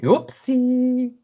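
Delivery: high, cutesy caught-out voice; stowaway caught hiding, sheepish and high; sheepish whoopsie. Retold or imitated. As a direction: play it like a kid caught out.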